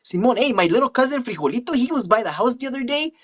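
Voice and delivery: high-pitched Chicano accent; cartoonish Chicano homeboy, way up high; Chico. Shown as a demonstration. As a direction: play an excitable vato in a high Chicano voice. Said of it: "a character who is a Chicano character".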